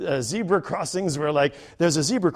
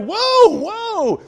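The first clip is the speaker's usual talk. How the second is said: much higher, loud startled backing off; alarmed whoa; 8 dB louder than usual. Retold or imitated. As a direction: jump back in alarm. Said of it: "And I'm like".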